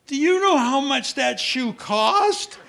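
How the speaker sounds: put-on exasperated parent scolding the boy about the shoe; prototypical parent. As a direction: deliver the classic parent line. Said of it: "the most exactly prototypical thing that parents say".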